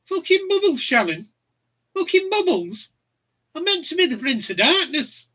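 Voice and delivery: exasperated high-pitched Brummie rocker; mock-outraged Brummie complaint, pitched right up. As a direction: whine it high in a Brummie accent, indignant. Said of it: "it were in a Brummie accent".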